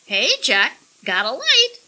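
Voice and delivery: high, loud leering parrot squawk; shrill bird with a leer. A narrator's reading. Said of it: "the parrot squawked".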